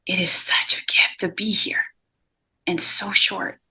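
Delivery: whispered, tender and hushed; soft whisper. Acted. Medium passing on brother's message, whispering. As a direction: breathe it out like a secret.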